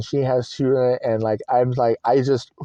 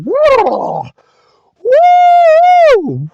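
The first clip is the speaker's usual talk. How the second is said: loud, high-pitched shake-it-off holler; irritated venting whoop; 10 dB louder than usual. Retold or imitated. Shaking like a dog to vent irritation, loud high whoops. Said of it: "all irritation, I'm like".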